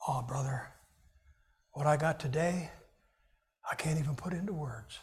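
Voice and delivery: soft, low, hushed and reverent; mock spiritual awe. Shown as a demonstration. Churchgoer faking a deep answer about his reading, hushed awe. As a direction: fake being too moved for words. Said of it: "Here's how you respond".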